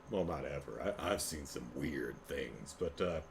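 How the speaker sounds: quiet aside. Real, not acted. Self-correcting aside.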